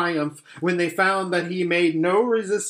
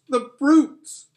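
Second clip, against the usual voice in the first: much higher, louder cry of outrage; tearful shout; 11 semitones higher, 8 dB louder than usual. Acted. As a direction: let the anger break out in tears. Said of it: "tears streaming down her cheeks".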